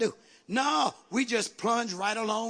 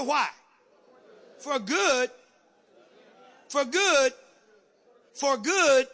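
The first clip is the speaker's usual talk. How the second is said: high, emphatic phrases with long pauses; call-and-response preaching; 6 semitones higher, 4 dB louder than usual. Real, not acted. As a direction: punch out each phrase to rouse the congregation. Preaches, prompting the congregation.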